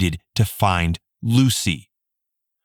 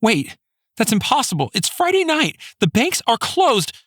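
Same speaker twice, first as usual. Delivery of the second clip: high frantic pleading; desperate disbelief, rising high; panicked protest. A narrator's reading. Desperate mother protesting ransom.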